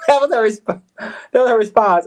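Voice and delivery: high, loud laughing words; laughing through it. Real, not acted. Laughing about mother's reply, loud laughing words. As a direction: crack up as you say it.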